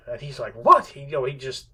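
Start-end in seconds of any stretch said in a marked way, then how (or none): 0.5-0.9 high, loud cry of disbelief; astonished outburst. Retold or imitated. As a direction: blurt it out, indignant and stunned. Acts out Odysseus's astonished outburst.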